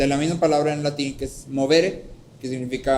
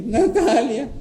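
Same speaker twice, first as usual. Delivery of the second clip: scared call; 8 semitones higher, 3 dB louder, much slower than usual. Retold or imitated. Calling to ghost girl again.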